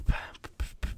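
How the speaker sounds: normal talking voice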